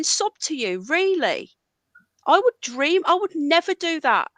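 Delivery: high, indignant outburst; incredulous at the disrespect; angry. Real, not acted. Streamer tells Ron he'd never do that, indignant. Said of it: "I'm still too angry".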